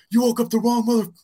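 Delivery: high, loud retold yell; imitating an enraged quarterback; mock scream. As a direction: yell it in his face, hammed up. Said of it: "screaming this at him over and over".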